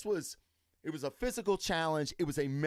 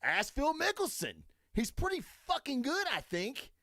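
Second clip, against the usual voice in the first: normal talking voice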